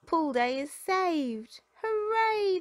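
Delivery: high, loud, slow triumphant cheer. A narrator's reading. Pups cheering that pool day is saved, loud and high. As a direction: shout hooray with joy. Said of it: "they all cheer".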